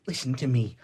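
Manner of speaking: ordinary talk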